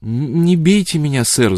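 high, stammered begging; whiny, frightened begging; whimpering plea. A narrator's reading. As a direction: plead as if about to be hit. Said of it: "захныкала она".

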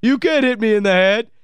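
slow, high mock whine on "I'm"; mimicking Dwayne Wade. Host quotes Wade from the clip, slow high mockery. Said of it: "the best one with the".

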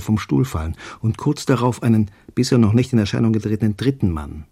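usual speaking voice

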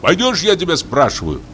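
high, insistent demand; angry shout. A narrator's reading. Hunter demands an answer from the forester, angry high voice. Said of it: "крикнул охотник, злобно тараща глаза".

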